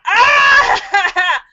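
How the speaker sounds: high, loud mock shriek breaking into laughter; mock scream. Reacting to a viewer's raccoon warning, mock scream. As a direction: shriek in play terror.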